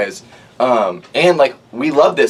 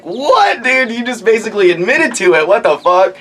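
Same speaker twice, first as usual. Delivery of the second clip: much higher, shocked disbelief; incredulous outburst; 10 semitones higher than usual. Retold or imitated. Friends reacting to his admission, high shocked disbelief. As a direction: shriek the disbelief.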